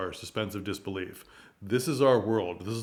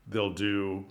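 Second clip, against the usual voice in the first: usual speaking voice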